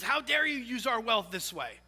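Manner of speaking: loud, high-pitched outrage; indignant. Acted. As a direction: protest hotly as the affronted brother. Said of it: "he's indignant".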